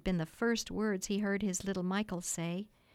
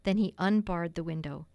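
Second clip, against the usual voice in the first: ordinary delivery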